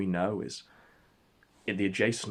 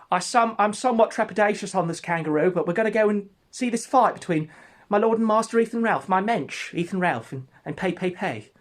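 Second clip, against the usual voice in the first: high, loud put-on voice, nervous pomp; a jittery fan praising his lord; trepidatious [name]; 10 semitones higher, 6 dB louder than usual. [name] on kangaroo speaks.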